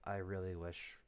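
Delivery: low, soft in-character confession of love; hushed and tender, a little hesitant; soft heartfelt confession; quiet confession. Acted.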